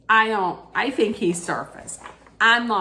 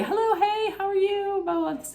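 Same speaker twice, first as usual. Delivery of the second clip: much higher, bright put-on woman's greeting; mock high greeting. Voicing Kelsey greeting the bachelor, in a much higher mock voice. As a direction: squeak out the hello like a giddy guest.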